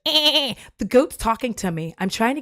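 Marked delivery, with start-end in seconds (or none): 0.1-0.5 high, louder bleating goat. Retold or imitated. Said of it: "it's like".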